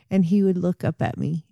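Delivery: ordinary delivery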